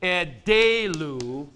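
edelu said slowly, each syllable clear; slow demo pronunciation. Shown as a demonstration. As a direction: spell out edelu slowly for the class. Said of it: "Here's what you've got".

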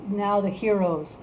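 usual speaking voice

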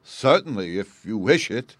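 king agreeing loudly. Acted. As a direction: grant it grandly. King grants it.

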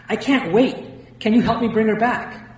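high, loud, urgent plea; panicked. A narrator's reading. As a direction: plead loudly, with no time to lose. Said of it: "I was in a full-blown panic".

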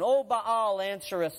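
ordinary talk, nothing marked

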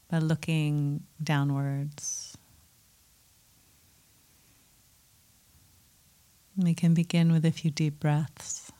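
calm meditation guide with long pauses; guided meditation. Acted. Teacher settling a group into mindfulness practice, calm guiding voice. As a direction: settle listeners in gently, leaving space between phrases.

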